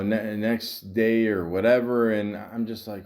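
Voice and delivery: ordinary talk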